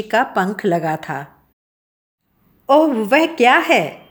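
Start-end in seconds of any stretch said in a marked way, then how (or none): 2.7-4.0 surprised, higher-pitched question; astonished question. A narrator's reading. Man spots the farmer and his cart in the hall, astonished. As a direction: ask in astonishment, pitch jumping up. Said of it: "आश्चर्य से कहा".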